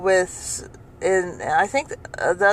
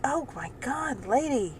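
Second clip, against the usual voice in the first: 1 exasperated aside; 6 semitones higher, 9 dB quieter, slower than usual. Retold or imitated. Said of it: "And I'm like".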